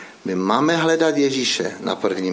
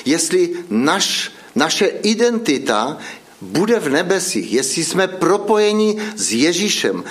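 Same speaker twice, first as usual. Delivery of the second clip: impassioned preaching; 6 semitones higher than usual. Acted. Preacher urging the congregation.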